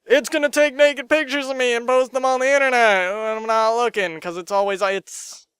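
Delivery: a hint of paranoid whining; lightly voiced paranoid worrier; paranoid mock voice. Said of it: "heard the arguments of".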